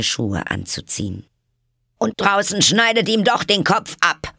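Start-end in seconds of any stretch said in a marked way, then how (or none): 2.0-4.3 furious queen. A narrator's reading. Queen orders beheading.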